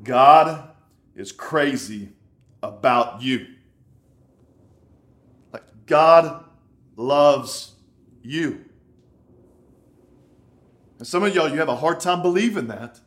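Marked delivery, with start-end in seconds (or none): 0.0-3.5 GOD punched very loud, then pause between phrases; urgent emphasis. Acted. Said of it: "listen, you gotta get this". 5.9-8.6 GOD and LOVES hit loud, each word set apart; emphatic. Acted. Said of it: "the Word of God is emphatic".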